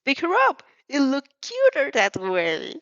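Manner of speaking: high, loud gleeful squeal; excited squeal. Acted. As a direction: squeal it like a delighted troublemaker. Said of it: "Midnight squeals".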